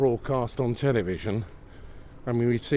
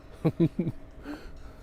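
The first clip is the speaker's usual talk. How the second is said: high, breathy giggle; helpless giggle at your own predicament; real laughing fit; 8 semitones higher than usual. Real, not acted. Walker wondering how to get down, laughing at themself. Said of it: "Stop laughing so much".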